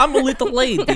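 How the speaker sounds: high, little-lady game character voice; high-pitched video game heroine impression; Misfortune. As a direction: put on a tiny, high girlish character voice. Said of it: "that fucking video game you played".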